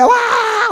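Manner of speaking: high imitated crying wail "vaa"; hammed-up child's crying wail; mock wail. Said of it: "అని ఏడుస్తాడు".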